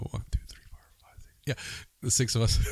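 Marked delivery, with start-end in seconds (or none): 0.1-1.4 whispered counting. Real, not acted. Counting family under breath.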